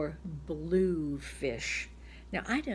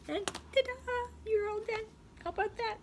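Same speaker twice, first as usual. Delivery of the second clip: much higher, bright ta-da for the finish; ta-da cheer. Acted. Teacher cheering the student for finishing the list, high and delighted. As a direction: sing it out like a little fanfare.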